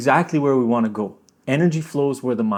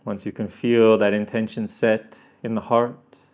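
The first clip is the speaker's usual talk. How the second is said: guided meditation; 4 semitones lower, 4 dB quieter than usual. Acted. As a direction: gently lead them inward. Guides intention setting.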